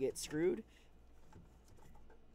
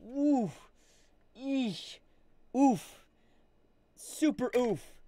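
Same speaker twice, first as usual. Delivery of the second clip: pained oof; 6 semitones higher, much slower than usual. Real, not acted. Reacting to broken headphones.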